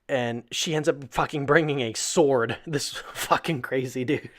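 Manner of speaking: ordinary talk, nothing marked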